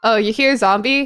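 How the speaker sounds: ordinary talk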